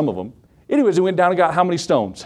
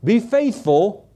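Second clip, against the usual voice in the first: slow, higher, earnest fatherly charge; 6 semitones higher, much slower than usual. Retold or imitated. David charging his son Solomon, slow and earnest. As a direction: lay it on him slowly like a dying king. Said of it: "David reiterates to him, says".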